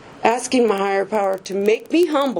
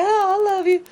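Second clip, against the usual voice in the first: sweet farewell; 9 semitones higher than usual. Retold or imitated. Sponsor's farewell voiced.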